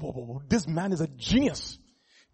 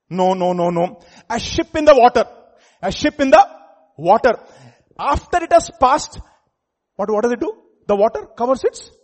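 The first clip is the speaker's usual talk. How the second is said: fiery loud preaching, high and loud; 5 semitones higher, 11 dB louder than usual. Acted. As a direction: thunder it from the pulpit. Preaching at full force.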